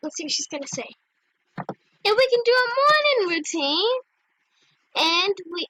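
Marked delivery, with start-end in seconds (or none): 1.6-4.0 higher, lilting pitch of an idea; eager suggestion. Real, not acted. Proposing a morning routine to new friend, eager. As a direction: pitch it brightly in one flow. First says the idea brightly.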